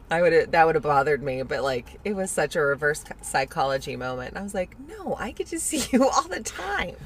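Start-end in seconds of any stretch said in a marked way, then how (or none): 4.8-7.0 laughing through the retort; giggling while she says it. Retold or imitated. Joking retort to daughter, laughing.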